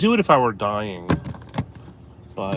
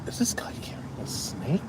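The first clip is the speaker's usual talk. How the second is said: hushed, breathless whisper; 3 semitones higher, 9 dB quieter than usual. Real, not acted. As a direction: whisper it so he can't hear you. Spotting a man with snake.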